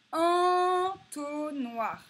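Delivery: teacher sounding out Entonnoir; slow, sounded-out word. Shown as a demonstration. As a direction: stretch En at the start of Entonnoir. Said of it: "on l'entend au début".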